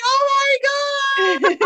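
high slow shout of joy; screaming. Retold or imitated. Told she won the prize, screaming with joy. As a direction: scream it out in disbelief. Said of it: "I was just there screaming".

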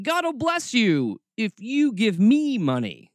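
mock a prosperity preacher working the crowd; televangelist. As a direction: parody snake-oil preacher, voice lifted. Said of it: "those televangelist days".

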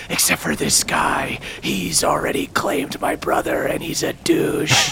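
scornful low whisper. Acted. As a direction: hiss it out as a spiteful aside. Queen whispers about Hartman.

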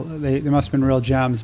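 ordinary talk, nothing marked

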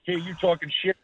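brash trash-talking promo; cocky heel promo; wrestling promo. Acted. Enzo cutting a promo.

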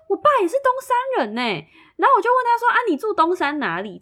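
兴奋地拔高音调; 声音高亢地追问; excited high voice. Retold or imitated. Excited retelling of discovery. (激动地高声说出认亲发现)

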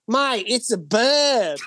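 slow, drawled Aussie truckie voice; deadpan Australian bloke stating the obvious; truck driver quote. Retold or imitated. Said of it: "he's like".

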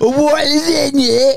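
high, slow, laughing Cockney; laughing mock Cockney. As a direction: crack up mid-phrase in a mock Cockney. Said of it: "do the voice".

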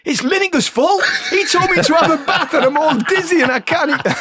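high, loud mock whine to the manager; whining Gazza. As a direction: blame your teammate like a sulky kid. Gazza blaming Lineker to manager.

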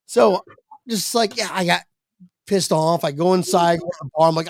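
ordinary talk, nothing marked

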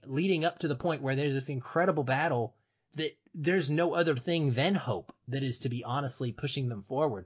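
ordinary talk

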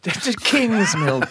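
slow, drawn-out put-on voice on the name; character voice. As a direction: stretch the name out in a mock character voice. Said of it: "you have to put on your character voice also".

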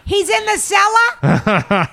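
high, slow, hammed-up voice; high put-on voice. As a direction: drag it out high and silly. Mocking the basement call.

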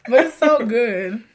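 laughing through a stuffy nose; laughing sick voice. Real, not acted. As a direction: giggle while sounding under the weather. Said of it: "I am beyond sick".

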